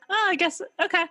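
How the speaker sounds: high, lightly voiced resigned acceptance; a playful, shrugging okay; mock shrug. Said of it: "it's easy to just go".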